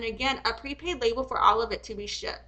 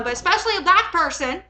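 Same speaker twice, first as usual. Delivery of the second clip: usual speaking voice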